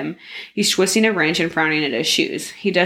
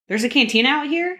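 surprised question; 8 semitones higher than usual. A narrator's reading. Snap surprised by cantina.

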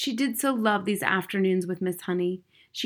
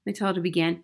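ordinary delivery

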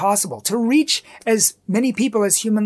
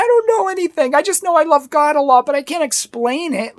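flustered pleading; 6 semitones higher, 4 dB louder than usual. Retold or imitated. Retelling being beaten in argument.

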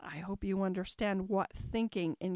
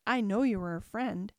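usual speaking voice

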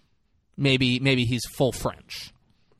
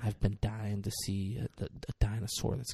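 soft, breathy near-whisper of amazement; overwhelmed mock reverence, barely voiced; hushed awe; 3 semitones lower, 7 dB quieter than usual. Acting out boss's hushed awe at dinosaur.

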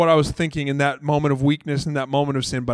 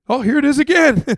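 much higher and louder, eager; excited bystander; 11 semitones higher, 8 dB louder than usual. Acted. Disciple sees Jesus ask Peter again, excited and loud. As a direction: here it comes, thrilled.

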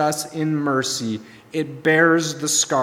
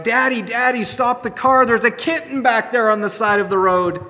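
put-on high, excited kids' voice begging Daddy; pleading children; 7 semitones higher, 3 dB louder than usual. Children in the back seat begging Daddy to stop the car, high and pleading. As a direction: play a child tugging at Dad to stop.

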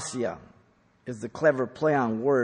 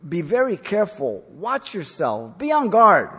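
put-on raised, higher urgent warning to children; urgent parental warning; 7 semitones higher, 9 dB louder than usual. Parent warning the kids, rising to a loud urgent call. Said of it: "As if to say".

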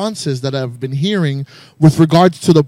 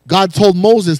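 usual speaking voice

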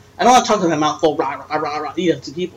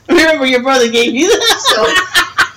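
laughing; 7 semitones higher, 3 dB louder, slower than usual. Real, not acted. Laughing reminder.

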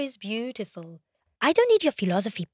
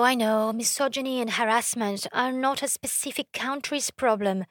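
ordinary talk, nothing marked

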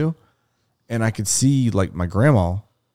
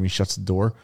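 normal talking voice

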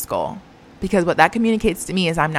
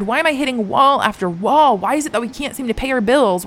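ordinary talk

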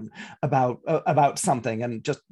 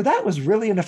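mock scolding; 5 semitones higher, 4 dB louder, faster than usual.

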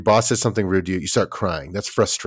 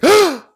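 sharp shocked gasp, much higher; mock gasp; 8 dB louder than usual. Parent gasping at a child's crying, sharp mock gasp.

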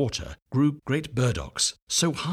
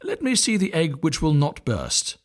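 put-on higher, bossy old duck; a hint of a know-it-all neighbour; old duck visitor; 7 semitones higher, 3 dB louder than usual. A narrator's reading. Old visitor demanding to see the egg, higher voice. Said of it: "said the old visitor".

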